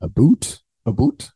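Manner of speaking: "A boot?" said as two words, like a boot on your foot; mock Canadian a boot. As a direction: lightly poke fun at the Canadian "about". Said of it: "the tongue is higher in the mouth".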